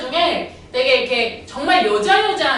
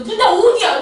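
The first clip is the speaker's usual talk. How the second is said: worked-up shouting; 9 semitones higher, 8 dB louder than usual. Retold or imitated. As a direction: fire the questions at the student in a rage. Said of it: "그날 내가 너무 흥분을 해가지고". (흥분해서 높고 크게 다그치는 외침)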